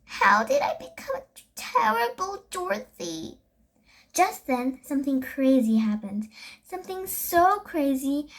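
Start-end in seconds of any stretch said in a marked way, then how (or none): 0.0-3.4 higher, despairing wail. A narrator's reading. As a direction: cry it out in dismay. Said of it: "Milani wailed".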